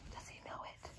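breathless suspense whisper. Acted. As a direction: lean in and whisper. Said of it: "aside to listeners".

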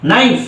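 "নাইফ" starting on N, no K sound; silent K. Shown as a demonstration. Teacher reads the newly spelled example, "নাইফ" with silent K. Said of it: "silent থাকবে".

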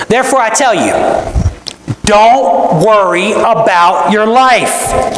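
slow, weighty, each word pressed; solemn scripture emphasis, slow; emphatic reading. Shown as a demonstration. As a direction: read it as a firm command. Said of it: "this is emphatic".

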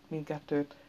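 usual speaking voice

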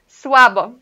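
"Słabo" said crisply as "suabo", falling; clear Polish model. Shown as a demonstration. Teacher reads the answer "Słabo" aloud to the class. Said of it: "Mandamos aqui".

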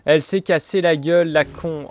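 loud laughing taunt; laughing shout. Real, not acted. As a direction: crow over her fall while laughing. Laughing at teammate's fall.

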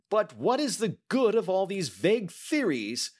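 high, loud, exasperated outburst; impatient cry. Acted. Banker cutting in on the detective's theories, impatient. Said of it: "cried the banker impatiently".

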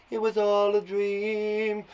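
high, slow, drawn-out dream voice; mock dream-sequence chant; dreamy echo. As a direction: float it out, stretching the last word. Echoes ending as dream effect.

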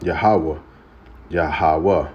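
"Yahweh" as ya-ha-weh, falling; Hebrew name. Shown as a demonstration. Preacher pointing to the name, saying "Yahweh" as ya-ha-weh. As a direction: repeat "Yahweh" deliberately, ya-ha-weh. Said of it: "see the Lord name at the top".

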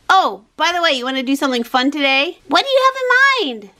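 ordinary delivery

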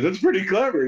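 high-pitched imitated director; retold pleased verdict, voice lifted; amused approval. Director praising the kid's [name], amused.

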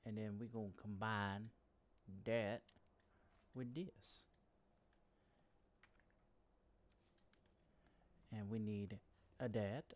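put-on light Cajun accent. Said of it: "talking in, like, a, uh, Cajun accent".

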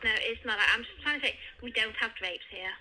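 ordinary talk

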